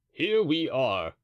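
slow, loud, pleased echo; Porthos echoing. A narrator's reading. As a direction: repeat it slowly with a grin. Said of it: "said Porthos".

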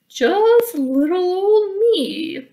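slow, loud, high giant bellow; drawn-out loud giant voice. Acted. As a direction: boom it out like a huge giant. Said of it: "bellowed the giant".

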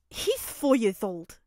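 loud, drawn-out dismissive scoff. A narrator's reading. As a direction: wave off the threat with scorn. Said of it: "Mineta scoffed".

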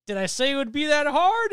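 loud, high-pitched hammy boast; villain's reveal. Retold or imitated. Retelling Mysterio's sudden change to friends, hammed-up shouting. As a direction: imitate a villain suddenly turning theatrical. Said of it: "all of a sudden he changes and goes".

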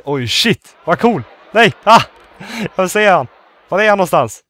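loud, high bursts of amazement; excited shouting. Real, not acted. Player sighting the celebrity visitor, loud excited outbursts. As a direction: cry out, thrilled and frantic.

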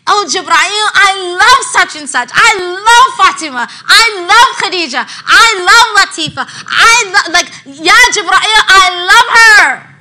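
emphatic declaration. Acted. Voicing divine love emphatically.

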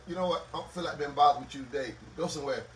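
low, soft, dismissive parent; imitated tired dad, low and quick; weary brush-off. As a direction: wave the child away under your breath, tiredly. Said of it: "and I'm like".

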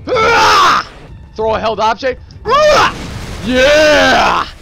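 excited shout. Real, not acted. Smashing things in game.

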